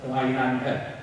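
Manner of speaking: ordinary talk, nothing marked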